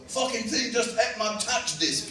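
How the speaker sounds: high, drawn-out character voice; Chalky. Acted. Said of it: "Chalky was a character that would always win".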